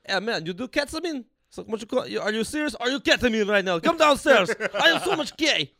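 put-on light Russian accent, rising excited and laughing. Comic imitating Russian neighbor Ivan inviting him down, excited Russian accent. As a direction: imitate a Russian neighbor thrilled about ketamine.

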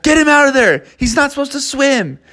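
high, panicked calling out; urgent yelling for help; alarmed crowd. Acted. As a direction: onlookers calling to save Techno Bear. Crowd alarmed by electrified bear.

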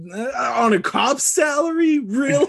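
loud laughing, high incredulous shout; laughing disbelief. Real, not acted. Mocking disbelief, laughing loudly.

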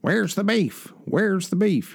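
mock old woman from the Wendy's commercial; little old lady. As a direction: squawk it like the cranky granny in the ad. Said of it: "that little old lady on the early Wendy's commercials".

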